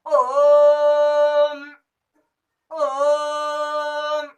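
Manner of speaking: ओम intoned long and loud; vedic om. Shown as a demonstration. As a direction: chant ओम as in Vedic mantras. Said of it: "ओम का उच्चारण ... कैसा आता". (वेद मंत्र की तरह लंबा ओम)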